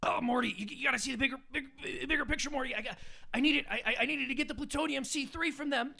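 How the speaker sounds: rambling self-important scientist, brushing it off; Rick impression. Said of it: "doing a Rick and Morty impression".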